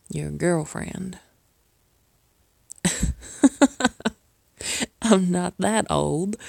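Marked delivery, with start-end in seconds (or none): 2.7-4.1 loud, higher-pitched burst of laughter; bright laugh. Acted. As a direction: laugh out loud at your own joke. Joke about girlfriend.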